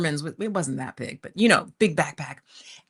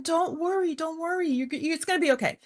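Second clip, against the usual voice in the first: higher, soothing mock reassurance; imitating a reassuring man; 9 semitones higher than usual. Stranger soothing her at the bus stop, high mock reassurance. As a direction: pitch it up to calm a stressed traveller. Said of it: "and he's like".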